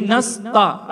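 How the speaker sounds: "nasta" with a thick t and dark back a; the mistaken heavy "ta" in nasta. Shown as a demonstration. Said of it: "Ada kesalahan juga di sini". (nasta dengan ta tebal)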